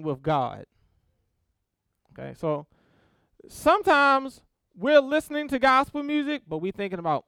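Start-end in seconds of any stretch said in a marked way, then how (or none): none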